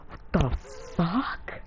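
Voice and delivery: drawn-out, halting curse rising at the end; acted disbelief. Jiraiya hearing Naruto already summons three animals, incredulous. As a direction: split the words, lift the last in disbelief.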